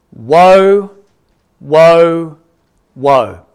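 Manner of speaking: loud, drawn-out woes stepping down in pitch; loud proclamation. Acted. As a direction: sound each one like a trumpet warning. Echoes the angel's loud warning.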